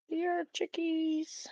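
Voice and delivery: high, soft, slow chicken call. Real, not acted. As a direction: coax the hens over gently. Calling to the chickens.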